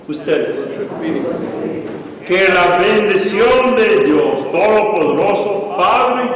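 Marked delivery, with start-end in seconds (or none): none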